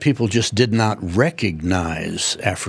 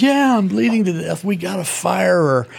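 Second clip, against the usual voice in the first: high, whiny kid wailing to his dad, hammed up; hammed-up whimpering boy crying to Dad; mock whiny sob story; 9 semitones higher than usual. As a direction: play the little brother overselling his injuries, much higher. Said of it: "And he says".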